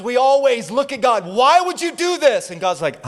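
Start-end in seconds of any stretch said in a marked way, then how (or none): 1.2-2.5 loud, high-pitched complaint; mock accusation. People blame God for their storm, mock outrage. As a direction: wail it upward as an indignant demand.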